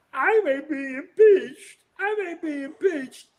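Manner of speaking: slow, high mock lament; drawn-out, hammed-up high wail; Trump. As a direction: mimic a panicking politician in a squeaky-high register. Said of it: "when he was talking to the Republicans yesterday".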